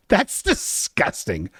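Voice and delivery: soft, slow, laughing through it; laughing disgust. Shown as a demonstration. Reacting again to the follower's name, laughing mock disgust. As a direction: giggle out the disgust. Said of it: "Very funny".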